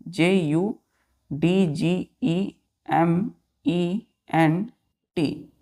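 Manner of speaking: each letter spaced out slowly for dictation; teacher spelling a word slowly so children write it down. Shown as a demonstration. Teacher dictating letters to children, slow and spaced. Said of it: "teacher spells word letter by letter".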